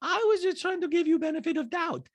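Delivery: high put-on voice, imitating a housemate; Diana. Diana explaining herself to Sutton, high mock impression. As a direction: mimic her in a shrill head voice. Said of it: "Diana goes".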